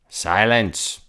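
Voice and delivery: loud barked command; a king barking at his subject. A narrator's reading. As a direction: bark it at him. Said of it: "barked back".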